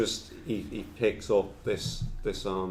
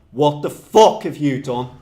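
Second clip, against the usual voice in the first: loud, high outburst of disbelief; mock furious demand; 6 semitones higher, 13 dB louder than usual. Darren confronting Sebastian, loud mock outrage. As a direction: play the angry boss exploding, half laughing. Acted angry confrontation in story.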